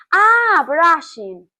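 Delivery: the penny drops, blurt it out; sudden realisation. Retold or imitated. Stylist's realisation. (exclamación de sorpresa, mucho más aguda y fuerte)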